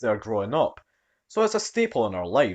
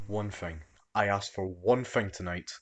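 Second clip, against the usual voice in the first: muttered annoyance; 4 semitones lower, 7 dB quieter than usual. Acted. Annoyed girlfriend sees him.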